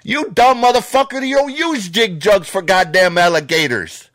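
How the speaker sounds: furious rant. Real, not acted. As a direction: let it fly, real contempt and fury.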